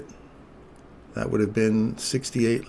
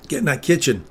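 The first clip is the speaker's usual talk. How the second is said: louder, higher rallying call; urging shout; 4 semitones higher, 5 dB louder, faster than usual. Real, not acted. Streamer loudly urging the price into the zone. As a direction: push the price like a coach.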